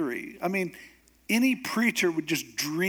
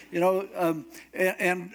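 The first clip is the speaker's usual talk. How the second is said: ordinary talk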